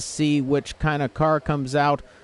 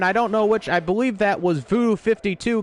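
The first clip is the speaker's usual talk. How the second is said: sudden shock at the crash; alarmed commentator; 7 semitones higher than usual. Real, not acted. Car compromised by pit exit.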